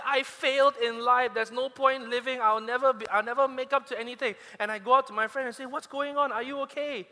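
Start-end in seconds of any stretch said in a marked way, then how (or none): 0.0-4.3 loud, higher mock wailing of a failed student; mock bawling. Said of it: "bawling her eyes out and say". 5.6-7.1 higher, worried asking; concerned friend. Retold or imitated.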